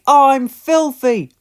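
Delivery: high, loud, slow wail; covered in coal dust and upset; dismayed. A narrator's reading. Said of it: "coughed Percy".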